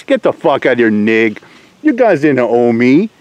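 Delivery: ordinary talk, nothing marked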